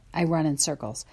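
plain reading voice

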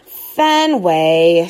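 firm scolding name call; girl snapping at her dog; sharp snap. A narrator's reading. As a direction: cut him off sharply. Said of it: "she snaps".

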